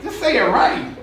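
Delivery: lightly hammed-up scolding with a laugh; mock disbelief. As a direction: act out a baffled listener, dragging the question. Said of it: "You're like".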